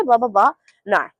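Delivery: ordinary talk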